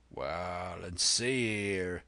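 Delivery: slow sea-cook drawl; unhurried pirate voice; Silver, sly old pirate. Acted.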